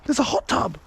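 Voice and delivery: high excited repeat. Real, not acted. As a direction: tell it with excitement. Discovering a hot tub.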